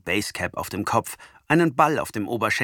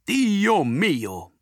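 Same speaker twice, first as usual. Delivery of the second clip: Italian exclamation; 8 semitones higher than usual. A narrator's reading. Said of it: "denn Antonio ist Italiener und sagt nie".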